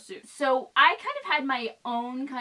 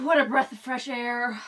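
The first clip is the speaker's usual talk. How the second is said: ordinary talk, nothing marked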